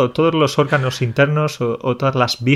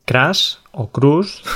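unsure; 3 semitones higher, much slower than usual. Real, not acted. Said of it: "o como sea".

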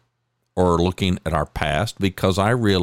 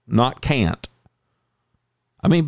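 usual speaking voice

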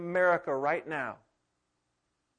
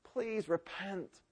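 much softer, slow, pleading; a gentle, hushed last appeal; soft slow plea to repent; tender plea; 4 semitones higher, 10 dB quieter, much slower than usual. Acted. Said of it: "What I hear in the Lord's voice".

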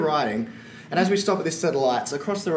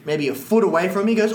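ordinary talk, nothing marked

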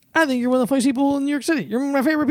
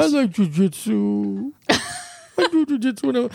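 droopy dog voice; slower than usual. Shown as a demonstration. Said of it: "Now I'm drooling".

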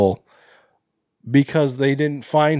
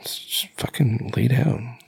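slow, breathless whisper; slow whispered collapse; exhausted whisper; 4 dB quieter, slower than usual. Pretend. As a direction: sigh it out like someone too tired to walk. Imitating exhausted survivor.

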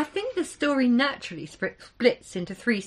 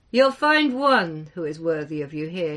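usual speaking voice

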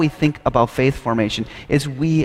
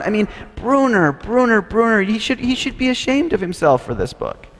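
high, exasperated outrage building up; indignant rant; 7 semitones higher than usual. Acted. Dietrich fuming to Walter about Brunner's book, high indignant rant. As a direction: vent your disappointment in the book, pitch climbing.

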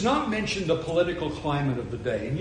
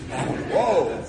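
ordinary delivery

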